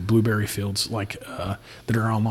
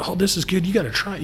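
higher, excited voice of the sneaky brother; imitating brother; 8 semitones higher, faster than usual. Little brother sneaking bourbon in the basement, high gleeful imitation. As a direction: play the brother sampling bourbon, delighted. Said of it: "I can just see him down there like".

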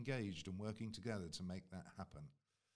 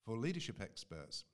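ordinary delivery

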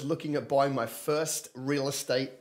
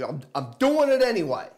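louder, punchy and defiant; with attitude; 5 semitones higher, 5 dB louder than usual. Shown as a demonstration. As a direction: say it like you mean it, defiant. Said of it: "better if you give it a bit of attitude".

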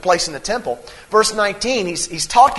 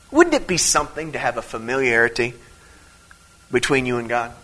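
normal talking voice

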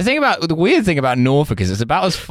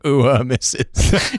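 put-on drawling Southern accent, lightly done; a touch of a Southern gent; 3 semitones higher, slower than usual. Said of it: "in, like, a deeply Southern accent".

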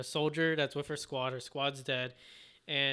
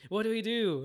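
higher-pitched retold alarm; lightly acted, higher panicky question; mock panic; 7 semitones higher than usual. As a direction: act out a soldier's panicked plea. Soldiers chased by Titans, retold.

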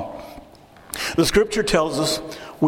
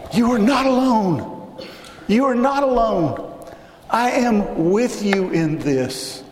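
raised, slow, ringing voice of God speaking; 8 semitones higher, slower than usual. Acted. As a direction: lift it up high and slow as God calling. Preacher voices God's words.

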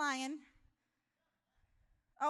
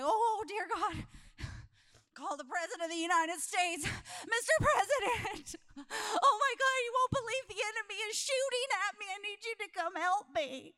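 a hammed-up soldier wailing for help; panicked soldier; 8 semitones higher, 5 dB louder than usual. Soldier under fire begging the President for help, panicked high wail. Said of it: "he's like".

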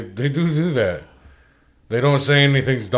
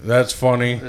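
normal talking voice

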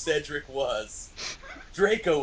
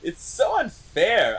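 high, whiny mock yell; petulant melodramatic protest; 8 semitones higher, 4 dB louder than usual. A narrator's reading. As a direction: complain loudly, hammed up. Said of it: "I yelled".

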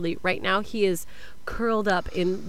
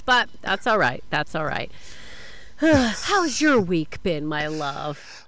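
ordinary delivery

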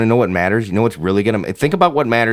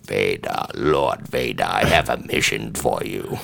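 deep, hushed sinister emperor voice; low quiet villain summoning his apprentice; Emperor Palpatine impression; 12 semitones lower, 5 dB quieter, slower than usual. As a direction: growl it low like a scheming Sith lord. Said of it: "Emperor Palpatine's like".

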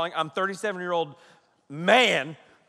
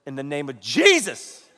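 Jesus stretched and swooping upward; hard praying; 3 semitones lower than usual. Retold or imitated. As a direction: punch the word Jesus in a mock-fervent prayer. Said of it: "that hard".

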